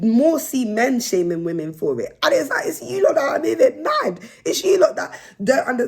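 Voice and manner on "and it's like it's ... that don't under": laughing while ranting. Real, not acted. Mocking men, laughing.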